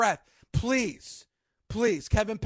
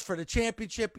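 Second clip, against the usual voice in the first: usual speaking voice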